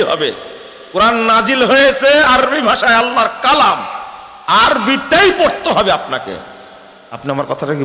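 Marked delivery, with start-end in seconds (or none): 0.9-6.3 high, loud, fiery sermon peak; preaching at full force. Acted. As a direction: thunder it out to the congregation. (উচ্চস্বরে জোরালো ওয়াজ)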